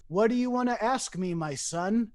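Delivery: ordinary talk, nothing marked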